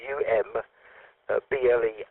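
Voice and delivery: normal talking voice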